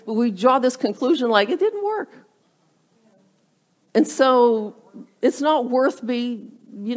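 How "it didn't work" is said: much higher, dismissive quote; mock complaint. Habit-former seeing no instant results, mock complaint. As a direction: mimic a naive quitter in a high voice. Mimicking a wrongly drawn conclusion.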